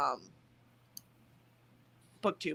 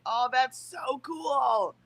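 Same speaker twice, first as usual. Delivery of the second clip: excited; 4 semitones higher, much slower than usual. Real, not acted. Reacting to viewer's design skill.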